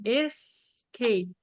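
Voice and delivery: escape slowed right down, es-ca-pe split into syllables; slow demo. Shown as a demonstration. Teacher slowing escape so students hear the syllables. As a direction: stretch escape out slowly. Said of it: "são duas sílabas".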